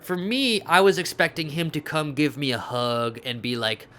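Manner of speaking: ordinary delivery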